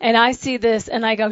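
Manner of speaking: ordinary talk, nothing marked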